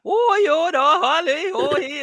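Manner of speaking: high, squeaky mock Irish back-and-forth; a shrill hammed-up Irish impression; mock Irish accent. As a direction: ham up Becky and Lyra in Irish accents. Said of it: "that Irish dialect going back and forth".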